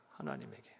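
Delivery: 하나님에게 repeated low and very soft; a hushed low repeat of 하나님에게; quiet echo. Shown as a demonstration. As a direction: drop the voice right down on 하나님에게. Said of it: "하나님에게 쏟는 거예요".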